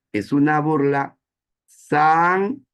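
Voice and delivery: sangrienta stressed high, loud and slow; emphatic definition. Shown as a demonstration. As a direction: lean hard on sangrienta. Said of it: "qué es un sarcasmo".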